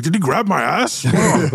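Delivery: high, startled exit; scurry off embarrassed; flustered dwarf. Acted. Beaten dwarf leaves embarrassed, high and flustered. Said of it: "he's just like".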